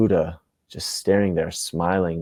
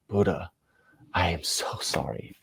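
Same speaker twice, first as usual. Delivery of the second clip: remorseful husband; 7 dB quieter than usual. Acted. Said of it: "And he starts to cry".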